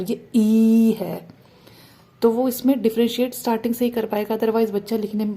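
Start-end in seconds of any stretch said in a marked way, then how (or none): 0.0-1.3 ई dragged out very slowly and louder; long ee, slowly. Shown as a demonstration. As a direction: hold ई long so the child hears it. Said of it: "ये ई है और".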